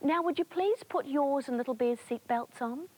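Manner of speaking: high, bright, polite hostess; air hostess voice. Acted. Air hostess welcomes Terence Teddy aboard, high and polite. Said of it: "the air hostess, oh, that's me".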